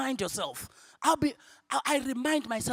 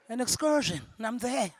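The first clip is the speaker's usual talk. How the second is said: usual speaking voice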